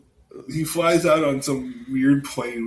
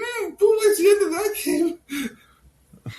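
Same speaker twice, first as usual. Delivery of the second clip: put-on childish Buu voice; faster than usual. Voicing Buu.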